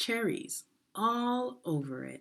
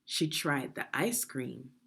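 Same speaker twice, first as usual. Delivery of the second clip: plain reading voice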